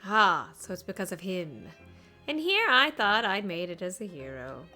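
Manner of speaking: ordinary talk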